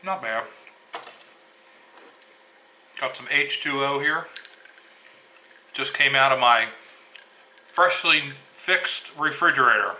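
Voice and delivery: talking through a mouthful of food; mouth full. Real, not acted. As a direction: speak around a bite of sandwich. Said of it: "I shouldn't talk with my mouth full".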